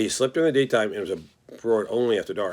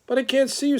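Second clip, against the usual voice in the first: much higher, startled little pig; 14 semitones higher than usual. Acted. The pig hears a strange voice in the dark, startled and high. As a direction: jump up in surprise.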